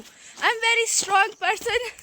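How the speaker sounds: slow, drawn-out affirmation, talking herself up; mock self-pep talk. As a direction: spell it out slowly like a motivational mantra. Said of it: "ऐसे खुद को यकीन दिलाना पड़ता है".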